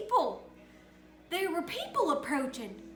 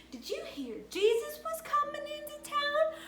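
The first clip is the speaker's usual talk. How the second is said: townspeople gossiping; 10 semitones higher than usual. Acted. Townspeople voiced in Bible story.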